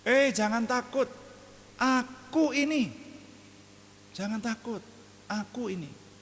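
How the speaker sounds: high, slow reassuring call; calm the frightened disciples from afar; comforting Jesus. Retold or imitated. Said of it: "Tuhan Yesus menghibur mereka".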